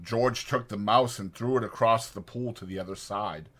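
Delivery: ordinary delivery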